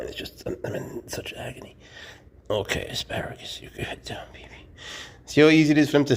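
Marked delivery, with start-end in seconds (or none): 0.0-4.5 whispered, straining; strained whisper. Real, not acted. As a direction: push the words out in a whisper.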